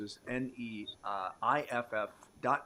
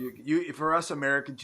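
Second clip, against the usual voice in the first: usual speaking voice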